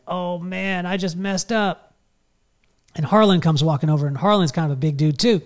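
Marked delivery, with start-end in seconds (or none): 0.1-1.9 high, soft, drawn-out inner voice; sinking realization, hushed and higher; mock dismay. Nervous dad realizing his mistake, quiet mock dismay.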